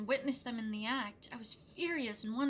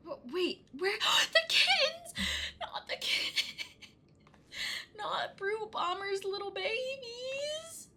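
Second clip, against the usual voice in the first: over-the-top mock alarm, cracking into laughter; mock panic; 10 semitones higher than usual. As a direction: shriek for the kittens in fake horror.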